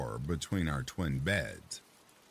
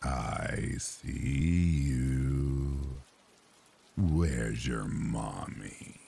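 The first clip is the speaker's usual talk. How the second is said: very slow, low, menacing; low creepy man's voice; 4 semitones lower, much slower than usual. Retold or imitated. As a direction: stretch each word out like a stalker. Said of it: "a very low and creepy man's voice".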